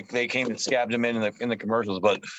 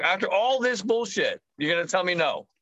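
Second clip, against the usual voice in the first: retold panicked outrage; 6 semitones higher than usual. Retelling his panic at being told no after months, high indignant mock protest. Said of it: "I was, like, having a panic attack".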